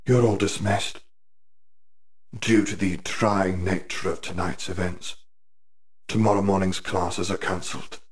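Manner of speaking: cool headmaster dismissing the hall; calm, detached authority after the killing; Riddle, lightly voiced.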